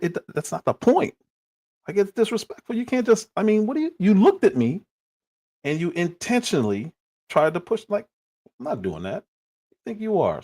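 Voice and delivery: high, heated retelling of an indignant rant; retold indignant rant. Arguing with his cousin about disrespect, high and heated. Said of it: "I was like".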